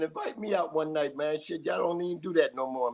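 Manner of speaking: ordinary talk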